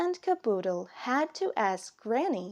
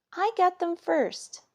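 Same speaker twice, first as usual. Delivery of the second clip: put-on high, louder kid's claim; child claiming first; 8 semitones higher, 4 dB louder than usual. Caboodle squabbling with Kit over pajamas, high insistent. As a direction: squabble like a little brother. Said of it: "said Caboodle".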